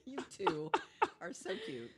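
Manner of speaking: usual speaking voice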